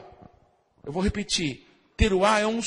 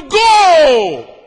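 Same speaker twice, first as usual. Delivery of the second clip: huge, high stadium yell of "Gol!"; explosive goal cry; goal shout; 6 semitones higher, 13 dB louder than usual. Retold or imitated. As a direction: bellow "Gol!" like a fan at a match. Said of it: "Deus tá no trono gritando".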